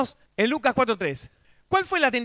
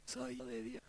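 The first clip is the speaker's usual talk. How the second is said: feeble reply; 22 dB quieter than usual. Shown as a demonstration. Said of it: "Así sa-, así sonó".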